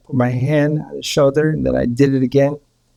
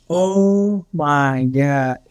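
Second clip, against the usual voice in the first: very slow, drawn-out awe; mom's awe; much slower than usual. Retold or imitated. Mother watching him revive, slow and awed. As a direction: stretch it out, overwhelmed. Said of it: "She's like".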